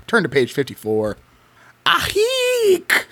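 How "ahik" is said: high, sharp mock shriek; hammed-up startled shriek; woman's shriek. A narrator's reading. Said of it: "the woman screams".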